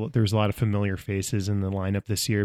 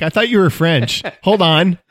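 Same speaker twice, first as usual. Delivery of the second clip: higher, louder startled double take; hammed-up surprise, much higher and louder; mock surprise; 8 semitones higher, 9 dB louder than usual. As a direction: act thrown by the accent. Mock surprised reaction quote.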